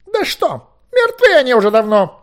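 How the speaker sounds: high, loud, exasperated snap; irritated outburst. A narrator's reading. Merlin, amazed Owen doesn't understand, irritated. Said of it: "раздражённо сказал Мерлин".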